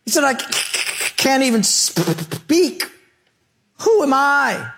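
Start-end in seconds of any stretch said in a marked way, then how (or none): 0.2-2.8 high-pitched, exaggerated stammer; imitated stutter. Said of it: "he stuttered". 3.8-4.7 high-pitched incredulous protest; Moses. Acted. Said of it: "You know what Moses' answer was?".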